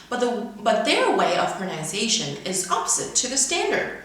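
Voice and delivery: ordinary talk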